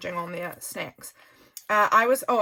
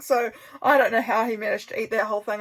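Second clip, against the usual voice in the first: ordinary talk, nothing marked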